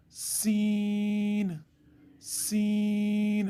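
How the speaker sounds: सीन with a long hissing s, stretched out very slowly; long whistling seen. Shown as a demonstration. Teacher stretching seen for viewers, long hiss. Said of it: "सीन को लंबा करना है".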